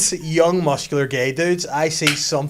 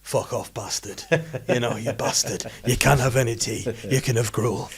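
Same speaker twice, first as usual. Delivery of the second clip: mock-stern Game of Thrones Yorkshire; gruff-humoured Yorkshire parent refusing dinner. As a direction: play a harsh Yorkshire dad, jokingly. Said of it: "Yorkshire voice is like Game of Thrones".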